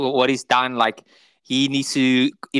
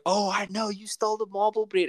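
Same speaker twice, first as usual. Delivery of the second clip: high, softer put-on voice; old woman's recognition; 8 semitones higher, 4 dB quieter than usual. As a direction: imitate an old woman suddenly realising. Retelling old woman's sudden recognition.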